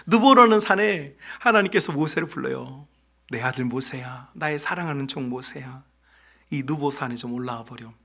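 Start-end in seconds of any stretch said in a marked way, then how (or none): 3.3-7.9 tender voice of God. Acted. God tenderly calls Moses.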